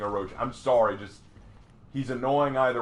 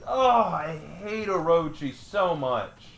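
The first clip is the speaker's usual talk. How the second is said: high burst slowing into a drawn-out complaint; fed-up gamer groaning out a grievance; exasperated venting; 3 semitones higher, slower than usual. Real, not acted. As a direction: vent real hatred at the game, leaning on it slowly. Venting hatred of opposing character.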